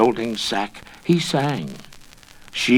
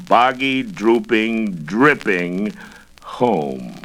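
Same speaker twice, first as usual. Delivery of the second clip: plain reading voice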